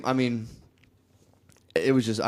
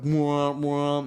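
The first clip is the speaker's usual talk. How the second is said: two long droning honks; imitated truck horn; 3 semitones higher than usual. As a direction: honk twice like a pickup's horn. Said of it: "like, loud horn".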